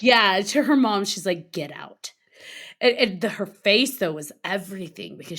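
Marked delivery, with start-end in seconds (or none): 1.5-2.1 low, soft mock command; retold anger. Angry cousin ordering her mom out, in understated anger. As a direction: imitate a mad child with quiet restraint. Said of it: "she was so mad".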